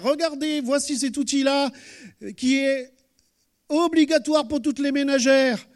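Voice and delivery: loud, high fairground barker pitch; snake oil seller. Retold or imitated. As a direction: hawk it like a market huckster. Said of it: "C'est un petit peu comme les bonimenteurs".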